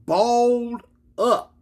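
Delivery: ordinary delivery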